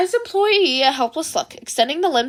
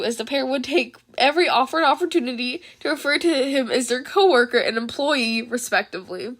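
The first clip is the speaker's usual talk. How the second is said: ordinary delivery, nothing marked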